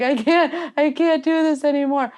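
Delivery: high, whiny retold wail; hammed-up, can't-go-on whine; mock despair. Replays her crying at race end.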